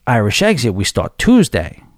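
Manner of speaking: normal talking voice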